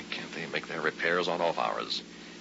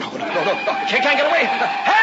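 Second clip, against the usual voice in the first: much higher, loud terrified cries building to a scream; panicked scream; 12 dB louder than usual. Acted. Driver facing a head-on crash, screaming in terror. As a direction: scream for help as the truck hits.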